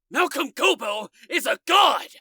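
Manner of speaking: yelled. A narrator's reading. Ben yelling his devotion to Malcolm at Leah. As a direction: shout it with zealot conviction. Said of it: "Ben yelled".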